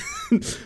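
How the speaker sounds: high squealing burst of laughter. Real, not acted.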